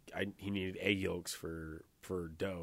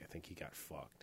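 whispered aside; 2 semitones lower, 12 dB quieter than usual. Retold or imitated. Whispered aside retelling reaction.

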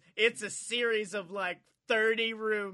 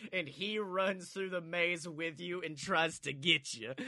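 normal talking voice

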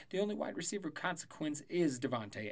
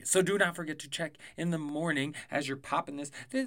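usual speaking voice

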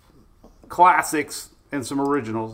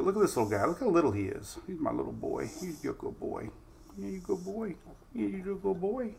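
quiet cooing puppy voice, much softer; 3 semitones higher, 12 dB quieter than usual. Real, not acted. Owner coos at two-year-old dog Hunter, soft baby talk. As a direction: coo softly at the puppy.

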